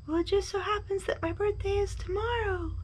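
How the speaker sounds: high, soft echoed reply; small distant voice, high-pitched. A narrator's reading. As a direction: repeat it like a faraway echo. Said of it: "his own voice echoed".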